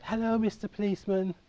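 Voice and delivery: put-on high, bright little-boy hello; imitating a small boy greeting the bobby; child's eager greeting. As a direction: sing it up like an eager young kid.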